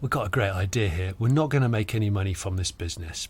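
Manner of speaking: low, soft conspiratorial pitch; confidential schemer. Retold or imitated. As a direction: lay out a plan quietly, as if in confidence. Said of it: "it's almost like the Spanish go".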